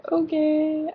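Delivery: high, thin "Okay"; squeaky okay. Retold or imitated. Answering the date request in a squeaky voice. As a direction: squeak it out nervously. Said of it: "I was like".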